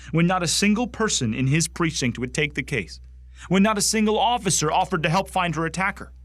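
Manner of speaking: high-pitched, aggrieved protest; worked-up, bitter accusation; indignant outrage. Acted. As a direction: drive each point home with indignation. Acted monologue, outrage at police.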